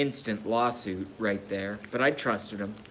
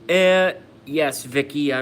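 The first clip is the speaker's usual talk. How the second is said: loud; 4 semitones higher, 10 dB louder than usual. Real, not acted. Answering a chat comment loudly.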